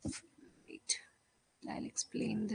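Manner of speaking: soft whisper, slow; whispered aside. Real, not acted. Teacher whispers to the class while preparing the drawing. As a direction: murmur it under your breath.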